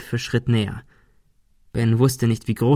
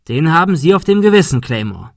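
higher, louder reproach; accusing; 5 semitones higher, 5 dB louder than usual. Acted. Ben blaming Claymore for a death, accusing. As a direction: hurl the blame at him. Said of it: "warf Ben ihm vor".

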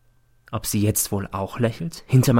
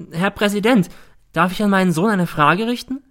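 higher, pressing courtroom request; mother's voice pitched up to address the judge; agitated mother; 9 semitones higher, 4 dB louder than usual. A narrator's reading. Mother asks the judge to question her son, agitated.

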